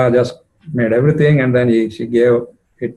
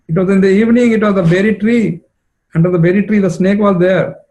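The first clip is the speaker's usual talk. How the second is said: high, louder, eager retelling; lightly voiced storyteller friend; 7 semitones higher, 3 dB louder than usual. Vishakha spins her snake story to Jatila, lightly voiced. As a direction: rush through the tale with urgency. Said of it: "the story she's telling now".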